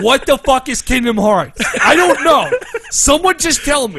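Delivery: high, loud, exasperated mock shout; hammed-up angry pleading, half laughing; mock anger. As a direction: impersonate a friend losing it in confusion. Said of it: "You're just getting angry".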